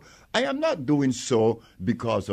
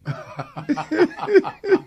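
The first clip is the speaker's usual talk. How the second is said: amused laugh; 3 dB louder than usual. Real, not acted. Laughs mid-claim about curing diseases.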